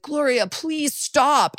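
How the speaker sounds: high, slow, whiny begging; imitating a desperate plea; mock pleading. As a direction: beg her to stop, hammed up. Defendant begging his girlfriend in court.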